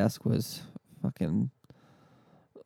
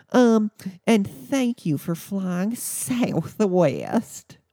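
exaggerated high pitch, drawn out slowly; mock an irritated agent, stretching each word up high; irritated customer service voice; 9 semitones higher, 3 dB louder, much slower than usual. Irritated airline agent confirming tomorrow's flight, hammed-up high service voice. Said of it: "they get irritated".

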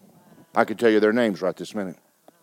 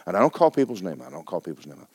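usual speaking voice

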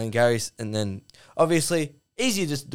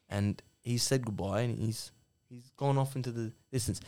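very soft, meek peacemaker; Rants; 3 semitones lower, 9 dB quieter than usual. Acted. Meek co-host tells Duck to calm down, hushed and tentative. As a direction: soothe him quietly, with hesitant gaps. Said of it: "just a bit simpy. Just a bit weak".

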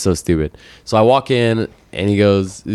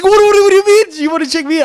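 very high, loud squawk of disbelief; incredulous outburst; 5 dB louder than usual. Retold or imitated. Patient told he can still check in, shrilly incredulous. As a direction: stammer the what, then shriek the question. Retold outburst at clinic kid.

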